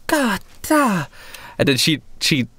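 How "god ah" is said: put-on high-pitched girlish yelps of pain; hurt girl. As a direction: yelp like a young girl with a bumped head. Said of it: "she's sort of rubbing the back of her head".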